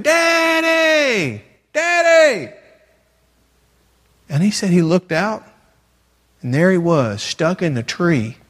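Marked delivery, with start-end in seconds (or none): 0.0-2.5 high, loud retold child's scream for Daddy. Boy stuck in a tree screams for his father, high panicked shout. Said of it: "he heard him screaming".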